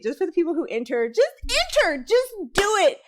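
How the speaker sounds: high, bright urging to just enter; excited urging. Real, not acted. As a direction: cheer them on to enter. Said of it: "Y'all just got all kind of excited".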